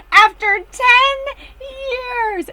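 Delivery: high, loud, slow shriek; mock-shrieked ten years; drawn-out shriek. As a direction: stretch it out in joy. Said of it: "She goes".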